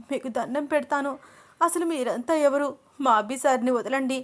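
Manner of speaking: high-pitched crying plea; narrator's acted weepy pleading; tearful begging. A narrator's reading. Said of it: "అని చాలా ఏడుస్తూ".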